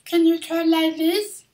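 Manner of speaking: put-on very high, slow baby talk; put-on squeaky baby voice. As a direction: squeak it out like a tiny child. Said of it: "talk in a baby voice".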